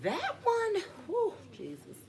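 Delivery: fighting back real tears; choked up. Real, not acted. Mother talking about her baby girl, voice breaking, choked up. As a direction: voice shoots high, catching with emotion. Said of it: "Let me just not get emotional".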